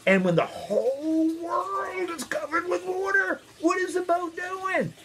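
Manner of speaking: very high, strained storytelling voice; hammed-up climax. A narrator's reading. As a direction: squeal it out for the children. Storyteller hams up climactic question.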